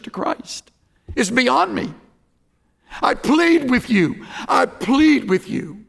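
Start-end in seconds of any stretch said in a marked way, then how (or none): 3.0-5.9 pleading. Real, not acted. Earnest altar call pleading.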